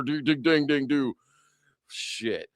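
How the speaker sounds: normal talking voice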